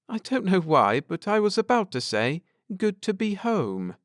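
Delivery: wondering aloud; lightly voiced Cynthia. A narrator's reading. Wife musing to Ned about home, lightly voiced.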